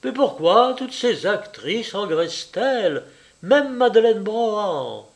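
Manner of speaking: high, animated society chatter. A narrator's reading. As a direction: raise it brightly for anyone listening. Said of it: "Elle haussa de nouveau la voix". (voix haussée, ton mondain animé)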